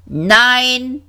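high, squeaky robot; high-pitched metallic robot voice. A narrator's reading. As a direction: pipe up like a tinny robot. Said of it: "tönte eine piepsige Stimme metallisch".

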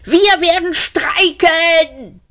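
high, slow, defiant shout. A narrator's reading. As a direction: yell out the strike threat. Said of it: "schrie Frumpfondell". (hoher, trotziger Schrei)

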